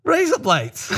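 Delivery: high, laughing through the words; giggling as you say it. Real, not acted. Reveals the joke laughing.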